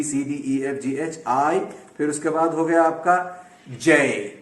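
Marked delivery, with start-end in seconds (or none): 3.8-4.3 "جے" stretched, gliding into an ee; teacher's model "جے", long vowel ending in ee; drawn-out letter J. Shown as a demonstration. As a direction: hold "جے" long, don't stop it short. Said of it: "ایسے روک نہیں دیں گے".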